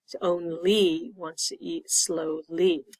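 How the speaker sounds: slowly read, every word clear; slow model sentence. Shown as a demonstration. Teacher reads sentence slowly for the Y sound. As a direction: read it slowly so pupils catch the ending. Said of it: "sounds like a E".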